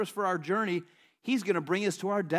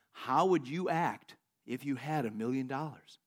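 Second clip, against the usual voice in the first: slow, lower and measured voice of God; the Lord speaking; 6 semitones lower, 4 dB quieter than usual. Retold or imitated. The Lord questions the praying man, slow low voice of God. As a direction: pose it slowly and gravely, low. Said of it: "And the Lord says to him".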